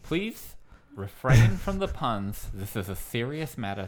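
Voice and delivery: lightly lisped pompous host voice; a faint lisp on every s, mock grave; mildly lisping news presenter; [name]'s lisp. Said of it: "It's really hard to do a lisp for this long".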